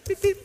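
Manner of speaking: horn imitation. Shown as a demonstration. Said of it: "You get one beep".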